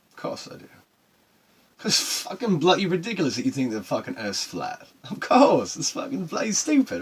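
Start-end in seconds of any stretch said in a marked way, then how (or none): 5.1-7.0 loud, high scoffing at the idea; scornful. Real, not acted. Sailor scoffing at flat earthers, loud and scornful. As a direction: shout down the flat earth notion.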